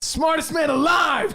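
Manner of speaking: high excited shout; loud boast. Real, not acted. Boasting about his memory.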